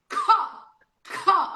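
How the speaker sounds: a clipped high "Có", no F; only the có sound. Shown as a demonstration. Therapist isolating the có syllable for patients. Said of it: "Agora você vai falar só o som do có".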